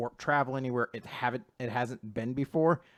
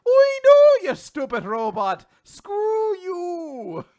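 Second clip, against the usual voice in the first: high, loud mock-angry Irish yell; 12 dB louder, much slower than usual. Captain yelling at the robot Pino in stage Irish. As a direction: scold the robot in hammy Irish. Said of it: "a stereotypical Irish guy".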